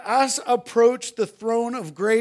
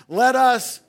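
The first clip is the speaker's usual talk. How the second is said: louder call; 2 semitones higher, 6 dB louder than usual. Shown as a demonstration. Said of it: "let's do it one more time".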